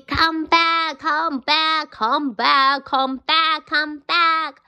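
chasing crowd yelling come back. Acted. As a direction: shout after the runaway. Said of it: "they were yelling".

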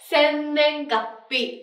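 sennengappi spelled out syllable by syllable, slowly; slow clear reading. Shown as a demonstration. Teacher slowly pronouncing sennengappi for the class. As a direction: read sennengappi slowly for students to copy. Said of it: "tiếng Nhật đọc là".

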